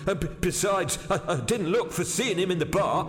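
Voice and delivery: high, stammering, agitated waiter; frightened waiter. Acted. Waiter explaining his scare in the bar, agitated.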